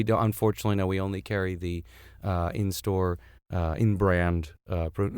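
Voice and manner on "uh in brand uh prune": usual speaking voice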